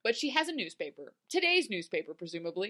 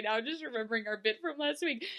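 ordinary delivery

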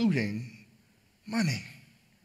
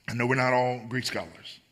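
ordinary talk, nothing marked

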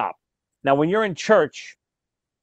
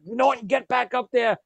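angry Ralph; 6 semitones higher than usual. Retold or imitated. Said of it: "Ralph is fired up".

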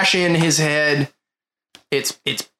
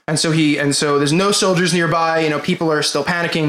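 ordinary delivery

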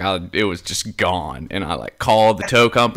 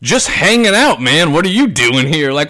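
high, loud sarcastic retort, faster at the end; mock your own snotty reply, pitched up; snarky mock retort; 8 semitones higher, 4 dB louder than usual. Retold snarky reply to kid.

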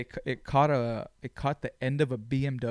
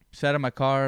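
normal talking voice

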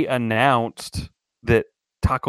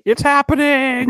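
loud, high, drawn-out mock panic; hammed-up apocalyptic shout; doomsday yell; 5 dB louder than usual. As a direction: yell it like the world is ending. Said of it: "run out of the building yelling".

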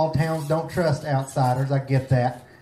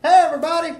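high, loud mock greeting; Jeff Blank; 13 semitones higher, 6 dB louder, much faster than usual. Guest comic greeting the crowd as an impression, high and loud. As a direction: pitch it way up and call it out. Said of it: "that was my Jeff Blank impression".

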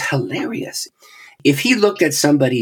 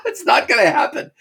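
laughing; 12 semitones higher than usual. Real, not acted. Laughing at his own resolve.

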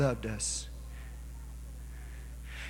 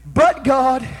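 high, loud, rising sermon cadence; preaching at full force; 8 semitones higher, 9 dB louder than usual. Acted. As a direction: preach it hard, each "but God" a hammer blow. Preacher building to climax.

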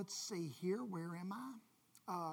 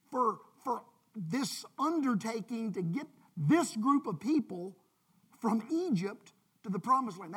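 louder, higher, desperate plea to God; Moses pleading with God; 3 semitones higher, 8 dB louder than usual. Pretend. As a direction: cry out as an overwhelmed Moses. Moses complaining to God, voiced.

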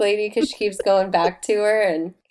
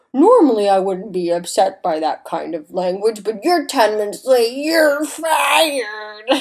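mock angry boss firing her, rising to a shout; hammed-up shouting boss; gruff boss; 2 semitones higher, 5 dB louder than usual. Boss firing her, acted quote.

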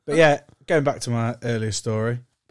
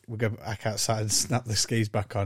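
ordinary talk

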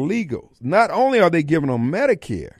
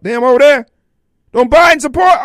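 higher, louder, incredulous retort; mock loud retort; 8 semitones higher, 9 dB louder than usual. Host answering Sally Struthers' plea, mock loud retort. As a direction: fire back the question, loud and high.

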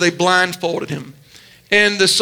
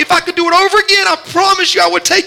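much higher and faster, breathless, begging forgiveness; desperate pleading; 8 semitones higher than usual. Retold or imitated. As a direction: cry out the apology in a rush. Peter's imagined desperate plea.

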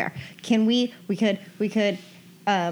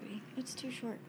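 ordinary delivery

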